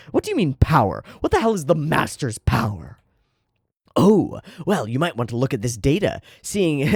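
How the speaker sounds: ordinary talk, nothing marked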